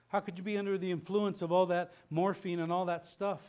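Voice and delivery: normal talking voice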